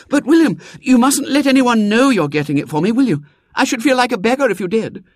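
put-on high, plaintive girl's lament; a girl fretting over her dress; complaining little girl. A narrator's reading. Girl pleading.

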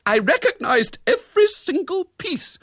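high, loud, emphatic insistence; prim, high-pitched severity; grim accusation. A narrator's reading. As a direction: state the damning fact indignantly. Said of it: "said Miss Milton grimly".

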